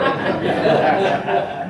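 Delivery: brief airy chuckle; quick breathy laugh; short laugh. Real, not acted. Audience laughter at repeat joke.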